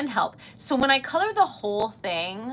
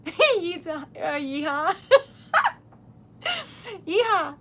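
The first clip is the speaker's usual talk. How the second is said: excited yeehaw; 7 semitones higher, 6 dB louder than usual. Real, not acted. Cheering a donation.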